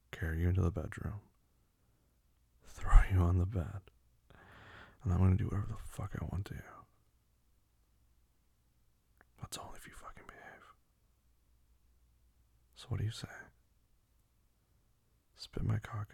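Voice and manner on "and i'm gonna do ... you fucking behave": dark commanding whisper. Acted.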